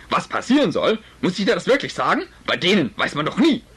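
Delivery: lauter, empörter Vater; wütender Vater. Acted. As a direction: schimpf los, laut und aufgebracht. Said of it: "eine recht laute Unterhaltung". (Vater streitet mit Mutter, laut und wütend)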